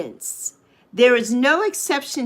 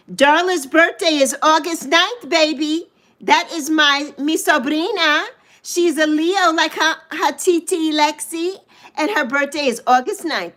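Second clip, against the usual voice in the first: high, loud, proud gushing; excited announcement; 5 semitones higher, 4 dB louder than usual. Real, not acted. Aunt tells livestream viewers about her niece's birthday, excited. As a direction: brag happily about family, pitched up.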